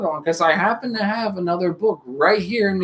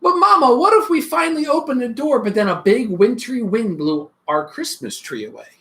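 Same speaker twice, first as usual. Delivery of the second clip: put-on eager little child calling Mama; a child pestering with questions; small child full of what-ifs; curious child; 4 semitones higher than usual.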